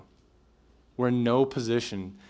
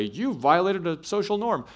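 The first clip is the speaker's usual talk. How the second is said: higher, slow, stiff mock-academic voice; mock formal phrasing; 8 semitones higher than usual. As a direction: parody a pedantic complaint. Mocking what they don't say.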